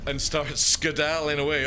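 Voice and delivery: ordinary talk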